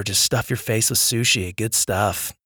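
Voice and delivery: ordinary talk, nothing marked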